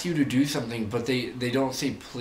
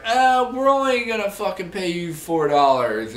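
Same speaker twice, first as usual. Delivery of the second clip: mock boss; 9 semitones higher, 6 dB louder than usual. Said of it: "they were like".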